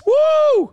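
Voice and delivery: short, loud, very high woo; excited whoop. Real, not acted. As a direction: let out one piercing whoop. Reacts loudly to pictures.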